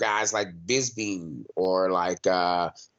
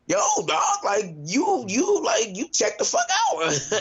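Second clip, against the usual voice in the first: much higher, slow, disbelieving "you checked the fuck out"; incredulous mock yell; 9 semitones higher, slower than usual. Watching a fighter quit on TV, high incredulous mock call. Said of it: "I'm sitting there looking like".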